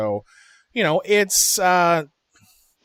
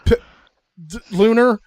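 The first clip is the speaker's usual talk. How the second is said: halting start into high, rushed apology; frozen, then apologetic; 5 semitones higher, 8 dB louder than usual. Retold or imitated. Friend apologizing to Lunar after an insult, stammered outburst. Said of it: "he just froze for a second".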